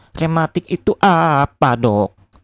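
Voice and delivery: lightly voiced puzzled old man; a hint of elderly drawl; old grandpa. A narrator's reading. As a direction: ask like a grandpa new to the word. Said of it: "tanya Kong Mamat lagi".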